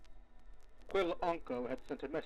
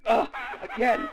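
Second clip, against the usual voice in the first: loud, higher, slow exasperated outburst; frustrated cry; 8 semitones higher, 10 dB louder than usual. Acted. Archivist cries out at yet another stalemate, frustrated. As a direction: vent your frustration at the board.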